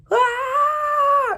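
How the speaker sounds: shrill distant scream falling away; high scream. Acted. Trids scream as the giant boots them, high loud. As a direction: scream high as you fly off.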